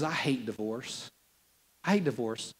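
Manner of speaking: low, hushed, measured pronouncement; grave quiet quote. Retold or imitated. Preacher quotes God on divorce, low and quiet.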